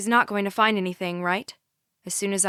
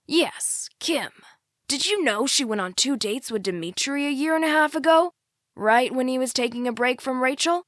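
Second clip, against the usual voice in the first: higher, sly probing sheriff voice; insinuating sheriff; 5 semitones higher than usual. Acted. Sheriff needles the girl about Dmitri, insinuating. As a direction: plant the suspicion slyly.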